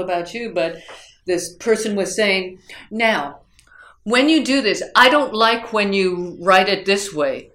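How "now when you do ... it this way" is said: louder, higher, emphatic imitation of a critical instructor; blunt criticism. Instructor criticizing a student's paper in mock conference, bluntly. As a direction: mimic an instructor scolding a student directly, with forceful stress. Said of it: "that directly and in that tone of voice".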